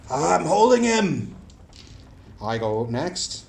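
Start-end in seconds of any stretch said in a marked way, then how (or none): 0.1-1.3 high, loud, slow straining yell; strained battle cry to the party; battle shout. Acted. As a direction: shout it while bracing against the ogre.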